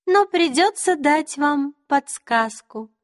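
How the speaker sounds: high, slow, teasing queen; high regal faerie queen. A narrator's reading. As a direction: sweetly toy with him. Queen voiced in the story.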